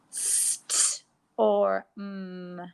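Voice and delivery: "St or m" split into three slow sound chunks; sounded out slowly. Shown as a demonstration. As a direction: stretch out each part of "St or m" slowly, with gaps.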